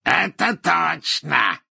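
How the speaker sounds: high, drawn-out exclamation; emphatic cry, stretched out; thundering. A narrator's reading. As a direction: thunder it slowly and proudly. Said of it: "загремел Мракс".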